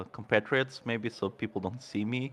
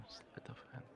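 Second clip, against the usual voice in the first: whispered; 2 semitones higher, 26 dB quieter than usual. Real, not acted. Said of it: "one more time".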